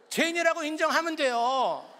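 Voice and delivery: high, loud preacher's cry; fervent preaching. Acted. Pastor urging the congregation to confess, fervent. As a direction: drive the point home.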